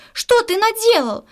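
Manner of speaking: slow, high-pitched outraged cry; high, drawn-out exclamation of outrage; outraged yell. A narrator's reading. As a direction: stretch out the accusation as a storybook yell. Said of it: "как заорёт".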